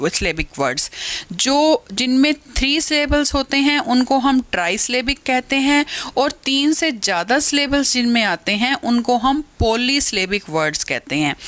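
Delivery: usual speaking voice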